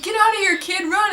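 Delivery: high, loud mock yell to run; hammed-up urgent yell at the kid; yelling at a kid to flee, high and loud; mock shout. Voicing hero yelling at kid.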